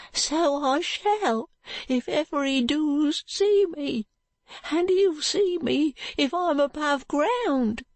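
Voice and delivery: put-on high, slow boy's voice, scared; a ragged boy retelling the man's threats in fear; frightened street boy. Joe's figure voice.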